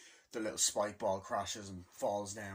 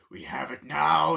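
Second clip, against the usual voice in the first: triumphant shout; 4 semitones higher, 12 dB louder, slower than usual. Retold or imitated. Koopas triumphant, retold.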